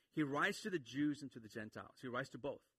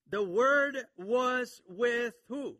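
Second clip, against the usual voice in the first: loud, high, very slow quiz; emphatic preaching; 8 semitones higher, 10 dB louder, much slower than usual. Real, not acted. Preacher quizzes congregation on the verse, loud and emphatic. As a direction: hammer each word out to the congregation.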